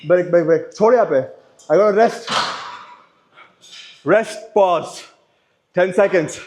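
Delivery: urgent strained shouting; loud and breathless. Real, not acted. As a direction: call for the break. Racking weight, exhausted.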